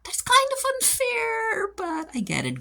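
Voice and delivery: squealing way up high; hammed-up shocked reaction, up in falsetto; mock dramatic reaction. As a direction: gasp it out like a scandalized fan. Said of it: "And I'm like".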